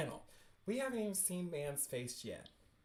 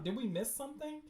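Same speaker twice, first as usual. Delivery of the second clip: usual speaking voice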